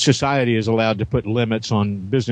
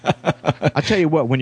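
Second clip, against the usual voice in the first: laughing; 5 semitones higher than usual. Real, not acted. Laughing at the joke.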